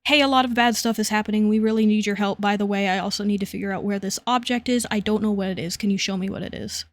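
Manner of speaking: quick, run-on mock urgency; rushed explaining. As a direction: rattle it off as if in a hurry. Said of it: "very quickly and out of breath explains".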